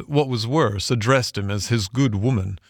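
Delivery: usual speaking voice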